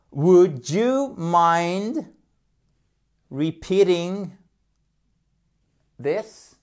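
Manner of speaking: very slow phrase broken by long pauses; stretched-out, careful English; slow teaching model. Shown as a demonstration. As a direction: dictate it chunk by chunk to learners.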